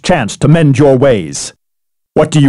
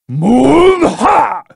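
battle cry; 10 semitones higher than usual. Acted. Special move battle cry.

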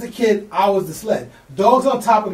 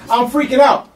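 ordinary talk, nothing marked

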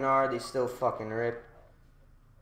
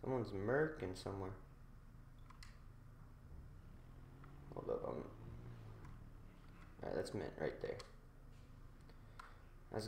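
quiet; 5 semitones lower, 17 dB quieter, faster than usual. Real, not acted. Quiet while waiting.

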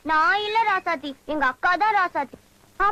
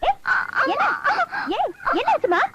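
worried calling; 10 semitones higher than usual. Acted. Asking the crying girl what happened.

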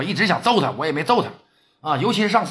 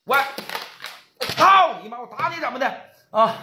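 high, heated threat; angry threat; 8 semitones higher than usual. Acted. Ye Laosan pointing at Wang Jianjun, high heated threats. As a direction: dare him and threaten back. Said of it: "叶老三指着王建军".

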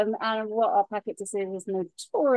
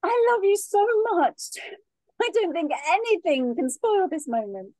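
overjoyed; 4 semitones higher than usual. Acted. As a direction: burst out happily after the yes. Just engaged, joyful celebration.

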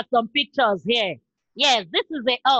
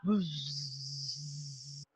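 buzzing bee; 12 semitones lower, 18 dB quieter than usual. Shown as a demonstration. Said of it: "Buzz around".

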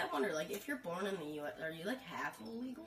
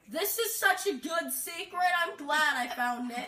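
loud, slow, high mock delight; exaggerated, drawn-out fake glee; sarcastic enthusiasm; 5 semitones higher, 7 dB louder, slower than usual. Real, not acted. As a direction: overplay your joy at a useless find. Sarcasm, nothing was there.

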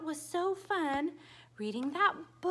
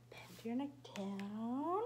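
whisper; 3 semitones lower, 7 dB quieter than usual. Acted. Whispered prompt to children.

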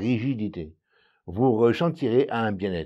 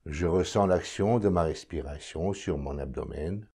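calm, measured meditation voice, lower and softer; guided meditation; 3 semitones lower, 4 dB quieter than usual. Acted. Meditation guide leading listeners to notice their breathing, calm and unhurried. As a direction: guide the listener inward, with long silences between phrases.

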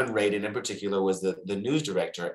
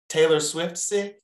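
raised, drawn-out sly answer; cheeky confession; 6 semitones higher, slower than usual. Retold or imitated. Retelling own excuse to boss, cheeky confession. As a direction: draw it out high, as a cheeky excuse.

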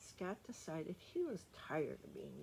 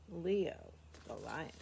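usual speaking voice

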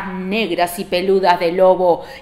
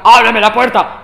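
loud, rough first try; wolf practising; 8 dB louder than usual. Acted. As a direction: bellow it as the wolf rehearsing. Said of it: "De pronto comenzó a practicar".

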